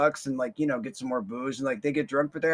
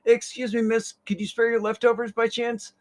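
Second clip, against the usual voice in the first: high pleading ask; polite begging kid voice; street kids begging; 8 semitones higher, 4 dB louder than usual. Retold or imitated. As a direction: ask sweetly for leftovers, pitched up. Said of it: "They're like".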